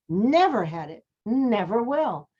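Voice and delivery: "Never" and "will" pressed, "will" drawn out slowly; emphasized words. Shown as a demonstration. Teacher demonstrating emphasis for the students, pressed words. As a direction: hit "Never", stretch "will". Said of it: "She kind of emphasized certain words".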